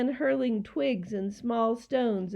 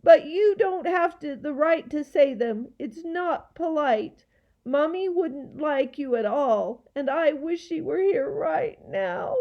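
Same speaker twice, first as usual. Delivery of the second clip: put-on high, upset little-girl lecture; scolding; 6 semitones higher, 4 dB louder than usual. A narrator's reading. Girl wishing mummy were here, scolding the gaboons. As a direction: scold them, voice raised in pitch. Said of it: "Lindy scolded".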